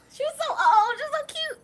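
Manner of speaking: high laughing squeal; laughing fondly. Real, not acted. Laughing fondly about mother.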